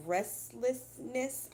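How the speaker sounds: slow, careful restlessness; slowed-down careful reading; said slowly. Shown as a demonstration. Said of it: "I have to say that word really slow".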